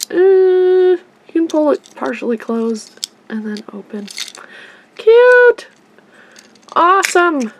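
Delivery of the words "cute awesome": high, loud delighted squeal; excited squeal. Real, not acted. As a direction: squeal it with joy. Delighted toy reaction.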